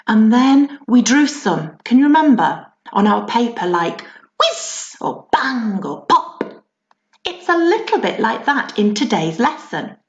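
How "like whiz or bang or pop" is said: slow, spaced-out whiz bang pop; firework sound words. Shown as a demonstration. Teacher recalling the bonfire-night sound words, slow and spaced. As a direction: savour each firework noise. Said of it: "noises that fireworks made".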